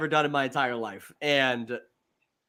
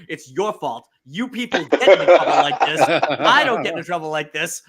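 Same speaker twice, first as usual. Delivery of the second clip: mock accusation through laughter; laughing it off at my friends; laughing blame; 7 dB louder than usual. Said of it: "I'm like".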